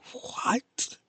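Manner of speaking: hushed, voiceless disbelief; stunned whisper. Retold or imitated. Viewer watching the shocking scene, stunned whisper. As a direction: whisper it as if lost for words.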